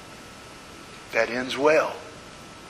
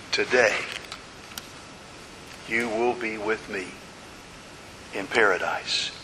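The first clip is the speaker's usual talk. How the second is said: gentle Jesus reply; 6 semitones lower, 8 dB quieter than usual. Acted. Jesus answers the dying thief.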